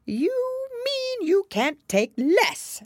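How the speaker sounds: high, loud, very drawn-out Hatter; correcting Hatter. A narrator's reading. Hatter correcting Alice about tea, pedantic. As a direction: correct pedantically, stretching each word.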